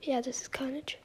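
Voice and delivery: hushed confirming whisper; real quiet whisper. Real, not acted. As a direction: whisper it to the teammate. Stealth whisper.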